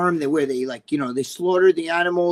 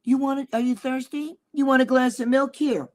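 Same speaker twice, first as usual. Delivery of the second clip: higher, sweet motherly offer; mother offering her son a glass of milk, mock-sweet; imitating mother; 7 semitones higher than usual. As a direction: coax like a doting mom offering milk. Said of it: "If your own mother said to you".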